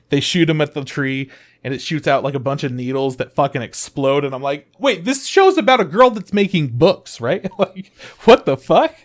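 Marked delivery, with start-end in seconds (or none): 4.8-8.9 high, loud incredulous reaction; mock-outraged confusion; baffled disbelief. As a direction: act out being totally thrown. Acts out own baffled reaction.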